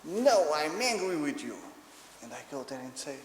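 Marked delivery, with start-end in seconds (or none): 0.0-1.6 loud, raised mock-angry child; upset little boy. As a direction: protest like a cross toddler. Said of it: "he gets very upset with me and he says".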